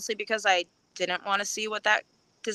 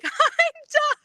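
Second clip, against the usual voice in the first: triumphant; 13 semitones higher than usual. Retold or imitated. Runner just handed her medal, high triumphant squeal. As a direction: squeal it in giddy disbelief. Reliving finishing the race.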